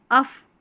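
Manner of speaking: loud 'अफ' ending in F; 'अफ' sound demo. Shown as a demonstration. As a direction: model अफ on its own, high and loud. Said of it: "अफ की ध्वनि". ('अफ', अंत में फ की ध्वनि)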